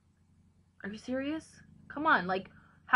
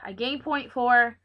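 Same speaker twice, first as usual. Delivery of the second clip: usual speaking voice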